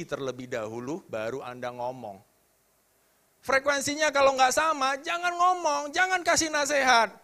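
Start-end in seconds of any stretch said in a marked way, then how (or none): 3.4-7.1 loud, high-pitched preaching at full force; impassioned preaching. Real, not acted. Preacher urging listeners to match others' feelings, impassioned. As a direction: preach it emphatically, voice raised high.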